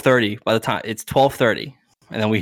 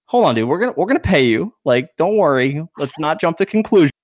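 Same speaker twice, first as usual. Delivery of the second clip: higher, louder hasty placating; placating dad; 6 semitones higher, 4 dB louder than usual. Retold or imitated. Dad stops the trainer's talk of court, placating. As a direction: cut in to calm someone down.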